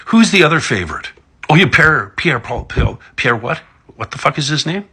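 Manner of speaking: ordinary talk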